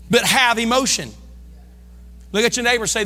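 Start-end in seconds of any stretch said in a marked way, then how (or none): none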